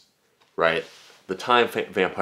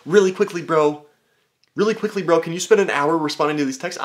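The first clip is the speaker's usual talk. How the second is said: high, loud mock bro voice; mocking a random dude online; pushy DM bro; 9 semitones higher, 5 dB louder than usual. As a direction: push hard for a favor. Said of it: "just being like".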